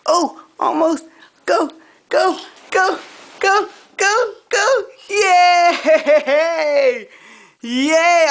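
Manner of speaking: high, loud, crying cheer; excited cheering. Real, not acted. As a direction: cheer them on with tears. Baby climbs up, parent cheers.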